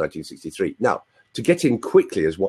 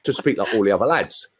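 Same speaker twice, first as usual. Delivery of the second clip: chatty London Cockney; Cockney with cheeky tag questions; Cockney accent. Shown as a demonstration. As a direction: slip into a Cockney lad's speech. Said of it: "I picked up a London accent".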